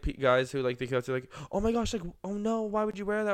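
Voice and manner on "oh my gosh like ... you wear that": higher-pitched imitation of an appalled stranger; mock dismay. Podcaster retelling strangers' reaction to the shirt, mock dismay.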